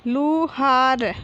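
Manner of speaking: slow, stretched लु, हा, र, falling at the end; drawn-out teaching reading of Luhar; syllable by syllable. Shown as a demonstration. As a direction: sound out Luhar piece by piece. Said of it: "तो हम इस प्रकार पढ़ेंगे".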